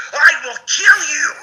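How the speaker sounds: very high, loud, drawn-out scream; reenacted scream. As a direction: scream it slowly as if waking from a nightmare. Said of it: "screamed in Anne's face".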